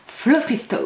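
Fluffy Stuff. in a put-on English accent. Shown as a demonstration. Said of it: "Avec l'accent anglais". (avec l'accent anglais)

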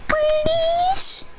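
high, breathy begging whisper; pleading whisper. Real, not acted. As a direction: beg sweetly in a whisper. Begging friend to play.

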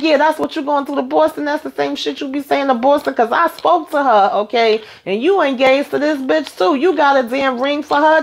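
high-pitched retold tirade; imitating her blowing up at him; retold fury. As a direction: girlfriend confronting her cheating fiancé, imitated rage. Retold confrontation, caught cheating.

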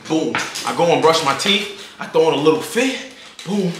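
ordinary delivery

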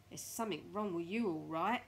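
loud, emphatic jeer; Mr Twit taunting. Acted. Mr Twit enjoying himself at his wife's expense, loud jeer. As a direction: throw it at her loudly, relishing it.

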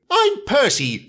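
high eager little engine; small engine voice. Acted. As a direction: pitch it up, young and eager. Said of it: "said the engine".

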